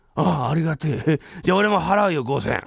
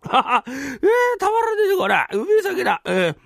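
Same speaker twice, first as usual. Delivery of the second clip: tipsy delight; 8 semitones higher than usual. Acted. Drunk brother savouring the sake.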